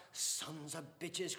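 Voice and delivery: slow, bitter cursing cry; cried curse. Acted. Father cursing the priests at the table, bitter cry. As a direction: drag out the curse with venom. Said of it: "cried Mister Dedalus".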